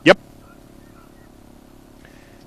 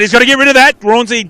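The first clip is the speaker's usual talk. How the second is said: sudden higher urgent commentary; excited call; 7 semitones higher, faster than usual. Real, not acted. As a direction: rise with the scramble, urgent. Hot contest, commentator rises with play.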